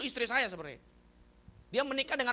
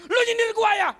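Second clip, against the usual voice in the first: sudden high, loud yell; angry outburst; 11 semitones higher, 12 dB louder than usual. Retold or imitated. Husband bursting into wife's room, angry shout. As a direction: explode at her in accusation. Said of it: "gua marahin lagi dia".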